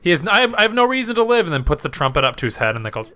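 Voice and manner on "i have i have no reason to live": high-pitched cartoon despair; mock despair. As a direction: wail it high like a hopeless cartoon man. Acts cartoon trumpeter in despair.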